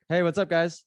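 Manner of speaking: high, overly friendly greeting; a lightly done bright, fake-nice hello; super nice. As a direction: sweetly greet them as if nothing happened. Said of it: "Like, super nice".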